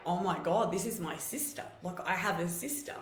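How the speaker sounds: usual speaking voice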